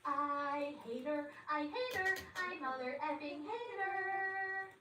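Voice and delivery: drawn-out, high mock wail of hate; tantrum. Girlfriend rages over the brother, childish tantrum.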